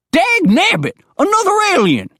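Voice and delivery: high, loud outraged holler; old coot blowing his top; bellowing. A narrator's reading. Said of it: "Digger bellowed".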